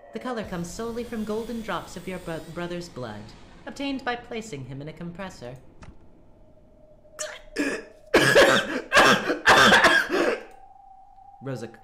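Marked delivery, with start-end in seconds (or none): 0.1-5.6 higher, gleeful cruel witch. Acted. Witch describes the cruel wine. 5.6-11.4 loud, choking high coughs; violent coughing fit. Acted. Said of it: "coughed violently".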